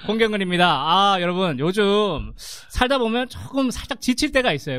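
bright, high salesy pitch; home shopping host. Shown as a demonstration. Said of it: "쇼호스트에서 소개한다고 하면".

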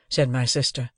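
ordinary delivery

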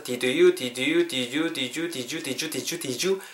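"did you" run together, from separate words into one; linked "did you". Shown as a demonstration. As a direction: repeat "did you", blending it into "di-ju". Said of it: "음이 연결되면서".

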